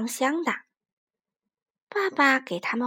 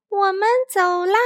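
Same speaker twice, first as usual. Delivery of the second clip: high, louder happy cry; excited child shout; 8 semitones higher, 7 dB louder than usual. Acted. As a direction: burst out the door cheering. Kids setting off excitedly.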